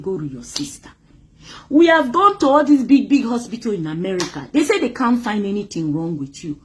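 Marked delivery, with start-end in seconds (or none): 0.0-0.9 much lower and much softer, like a voice from within; hushed inner voice. Retold or imitated. Retelling what the inner voice told her, hushed and low. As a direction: sink it down to a low murmur. That voice kept telling me.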